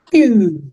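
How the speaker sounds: high, drawn-out "pew" sound effect; imitated falling pew. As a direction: voice a high little laser-like pew. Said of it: "it just sort of went".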